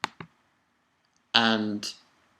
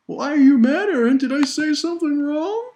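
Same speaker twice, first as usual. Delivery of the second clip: high, bright-eyed innocent voice, a little louder; mock-innocent high voice; 11 semitones higher, 5 dB louder than usual. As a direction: play dumb, all wide-eyed innocence. Acting out Reiner's innocent question.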